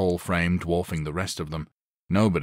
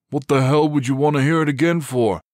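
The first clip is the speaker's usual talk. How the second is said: higher, louder incredulous outburst; blurted; 8 semitones higher, 7 dB louder than usual. Acted. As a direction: blurt it in disbelief. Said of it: "Dudley blurted".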